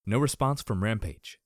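usual speaking voice

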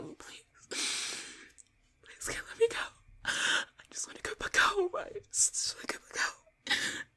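high, whispery sobbing plea; tearful pleading. Acted. Victim begging attacker to release her, tearful pleading. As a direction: beg through tears.

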